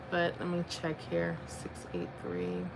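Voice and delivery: very soft, trailing into a low murmur; muttering to self. Real, not acted. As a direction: think aloud quietly while checking. Checks numbers, talking to self.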